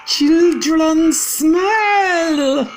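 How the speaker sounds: very high, loud, very slow shriek; witch scream. Acted. Grand High Witch denouncing children to witches, screaming. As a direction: scream it out in disgust. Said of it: "she screamed".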